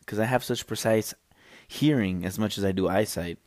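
normal talking voice

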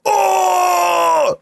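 long high held Oh of grief; drawn-out anguished oh. Retold or imitated. Retelling a grieving villain, long high mock wail. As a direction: hold a cartoonish wail. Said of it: "he gets pissed and is like".

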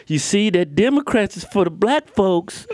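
a mock old mom voice warning her kid; mom impression.

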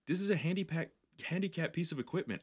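lightly voiced frustrated rider; exasperated insist.

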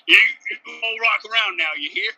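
lightly put-on Southern twang, teasing; Southern accent. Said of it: "it was supposed to be a Southern".

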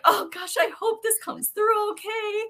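high-pitched, mock-anxious wishing; retold eager hope. Host retelling her hopes before the interview, high and eager. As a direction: lift the voice high for a retold hopeful thought.

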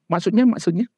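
high, eager prodding; eager mother. Retold or imitated. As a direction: beg to hear it again. Mother fishing for more praise.

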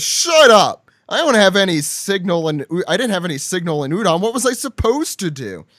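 loud, high burst, then protesting; hammed-up indignant outburst; indignant retort. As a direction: snap back loudly in self-defence. Acts Queen snapping back at Jack.

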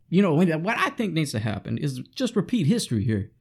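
ordinary talk, nothing marked

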